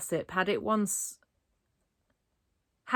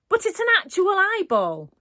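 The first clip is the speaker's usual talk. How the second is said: panicked; 12 semitones higher, 6 dB louder than usual. A narrator's reading. Panicked reply, loud and high.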